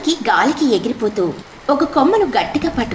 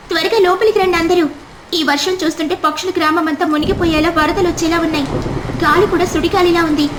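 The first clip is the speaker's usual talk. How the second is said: higher, hurried warning voice; urgent mother bird; 6 semitones higher, faster than usual. Acted. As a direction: rush everyone in from the storm. Mother urges all inside in storm. (తుఫానులో అందరినీ లోపలికి పిలిచే తల్లి, వేగంగా)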